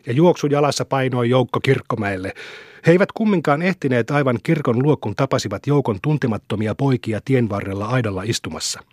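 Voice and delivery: plain reading voice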